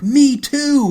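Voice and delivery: high, loud mock chorus cry; excited shout. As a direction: shout it out like a startled crowd member. Therapists all chiming in online.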